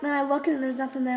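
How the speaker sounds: normal talking voice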